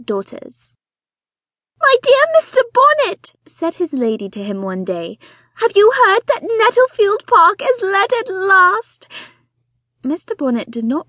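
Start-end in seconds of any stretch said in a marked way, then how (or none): 1.8-3.3 high, loud, breathless society wife; fussy excited mother. Acted. Narrator voices excitable Mrs Bonnet. 5.6-9.1 high, loud, breathless society wife; fussy excited mother. Acted. Narrator voices Mrs Bonnet.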